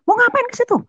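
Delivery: very high shrill mother asking; mother's voice. Retold or imitated. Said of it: "katanya gitu".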